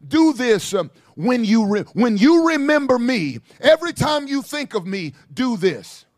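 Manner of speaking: ordinary delivery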